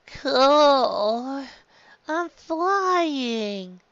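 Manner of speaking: put-on high, airy young boy voice; Luffy dreamy. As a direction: float up into it, lightly amazed. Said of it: "He smiled".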